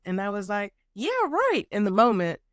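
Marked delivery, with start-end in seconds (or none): 0.9-1.7 high-pitched, disbelieving scoff; doubtful comeback, pitched way up; sceptical retort. Retold or imitated. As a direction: jump up high in mock disbelief. Sceptical reaction to friend's reassurance retold.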